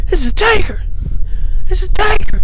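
high excited commentator call; J R and Lawler yelling. Retold or imitated. Imitating commentators hailing the Undertaker, high excited yell. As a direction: shout it like a wrestling announcer losing it. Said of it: "J R and Jerry the King Lawler yelling".

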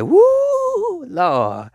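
long high whoop, then drop to a sigh; weary exclamation. Acted. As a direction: whoop up high like a fed-up churchgoer. Congregation's weary cry voiced.

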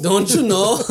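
mock Wisconsin don't you know, lifted up; a playful Wisconsin Midwest accent. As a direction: echo the Wisconsin catchphrase in a local accent. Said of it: "repeat what Mike says".

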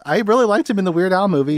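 ordinary talk

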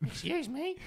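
very high mock woman's voice; high-pitched, querying interruption; indignant woman. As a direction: imitate a woman demanding attention in a high pitch. Imitating a woman challenging the caller.

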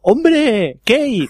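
put-on high, bright call to an old classmate; friendly greeting. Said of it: "y dice".

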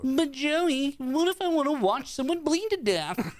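high whiny nagging voice; obnoxious driver voice. Pretend. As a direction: whine like an annoying driver. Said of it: "That's my obnoxious driver voice".

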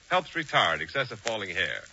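usual speaking voice

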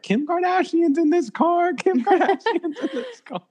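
put-on high-pitched child yelling out a window; kid yelling. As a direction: shout it out like an excited kid. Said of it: "just start yelling".

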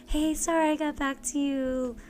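high, singsong, breezy chill voice; breezy and unbothered, pitched well up; mock chill friend. Imitating the chill woman texting back late, breezy. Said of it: "she's all chill about it".

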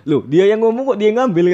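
loud, high retold objection; indignant protest. Retelling his protest about who took it, indignant.

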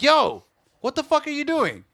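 high-pitched retold confrontation; indignant challenge. Garage owner challenging a tagger in his driveway, indignant. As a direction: call him out, pitch jumping up.

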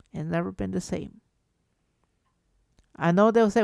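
usual speaking voice

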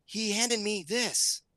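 high, slow imitation of a kid; daughter's reveal. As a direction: draw it out like an awed daughter. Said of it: "and she goes".

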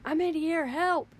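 high mock scream, urgent but not full force; imitated screaming. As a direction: act out a trapped woman screaming for help. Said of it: "there's a woman screaming inside her house".

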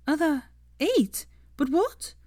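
high, outraged disbelief; can't believe what you heard; indignant disbelief. Acted. Hermione outraged at the lost wager.

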